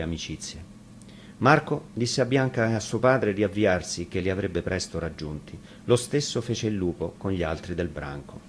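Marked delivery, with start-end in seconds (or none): none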